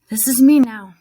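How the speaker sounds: plain reading voice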